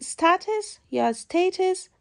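استاتس as 'STAT-us', استیتس as 'STAY-tus'; careful alternatives, استاتس or استیتس; two accepted pronunciations. Shown as a demonstration. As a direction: model both استاتس and استیتس with short endings. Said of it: "این لغت دو تا تلفظ داره".

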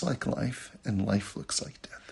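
soft unvoiced whisper. Teacher revealing a hidden truth to students, whispered. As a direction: share it like a secret.

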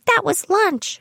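high mock child insisting. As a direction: retell a stubborn child's flat correction in a high voice. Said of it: "she insisted".

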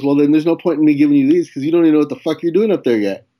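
quick, gruff retold manager brushing him off; dismissive manager. Manager tossing away the notes, fast and dismissive. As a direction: rattle it off, fed up.

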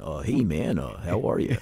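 put-on low, soft Midwestern guy accent. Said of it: "with that Midwestern accent".

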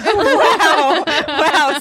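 laughing. Real, not acted. Reacts to the surprise Christmas greeting.

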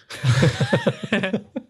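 long high giggling burst; high laughing fit. Real, not acted. Mate laughs during the mock casting couch bit, high giggling. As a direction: giggle helplessly.

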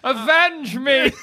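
slow, high ghostly wail; overacted death scene. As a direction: cry out for vengeance as a ghost. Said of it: "I die and become a ghost".

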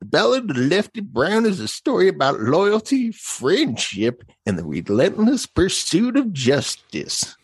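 put-on high, cranky old-timer reading a movie blurb; old prospector voice. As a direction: squeeze it up into a wheezy old gold miner. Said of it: "Anytime he talked, I was like".

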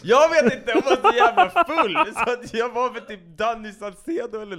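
high, loud words squeezed through laughter; laughing. Real, not acted. Cracking up retelling the drunk partner story, real laughter. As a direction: laugh your way through the line.

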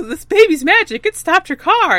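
high, loud, eager insistence; excited Hiro. Retold or imitated. Hiro insisting to the trucker that the baby is magic, high and eager. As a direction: blurt it out like a wide-eyed believer.

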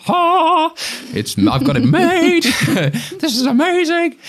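high, loud burst of elation; over-the-top thrilled outburst; elated. Retold or imitated. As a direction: crow with mock triumph, voice soaring. Acts out his youthful elation.

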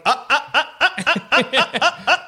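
raptor noises. Retold or imitated. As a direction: yap out high, laughing dinosaur calls.

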